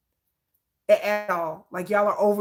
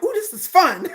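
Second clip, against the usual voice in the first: high gleeful ooh with a laugh; 9 semitones higher, 4 dB louder than usual. Retold or imitated. Savouring a comment fight with trolls, high gleeful. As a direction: relish the fight ahead. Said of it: "I'm like".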